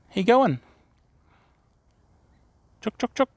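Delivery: high sing-song hen call; calling chickens. Real, not acted. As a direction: coax the hens over, high and light. Greeting her chickens.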